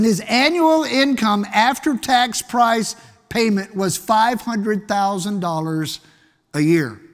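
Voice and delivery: "annual" stressed, whole figure spelled out slowly; slow and deliberate. Shown as a demonstration. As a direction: lay out each word of "his annual income" slowly. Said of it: "li-, listen".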